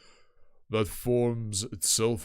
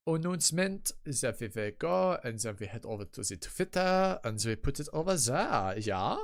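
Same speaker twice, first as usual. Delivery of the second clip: put-on German accent. Streamer announcing the Twitter post, in a silly German accent. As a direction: talk through the chores in mock German. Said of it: "Accent, go away!".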